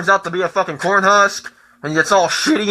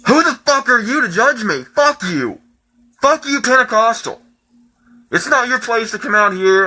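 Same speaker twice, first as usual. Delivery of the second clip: angry outburst; 5 semitones higher than usual. Real, not acted. Ten-year-old at church telling off the congregation, angry. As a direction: snap back at them in real anger.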